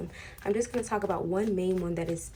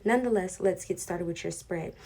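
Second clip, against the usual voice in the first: ordinary talk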